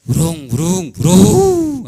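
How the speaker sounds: loud, high vroom vroom building to a roar; imitating a car starting up loudly; engine revving. Shown as a demonstration. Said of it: "Así era".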